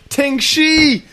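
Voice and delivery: high excited hype call; hype shout. Real, not acted. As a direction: call the name like a ring announcer. Hyping a debuting wrestler.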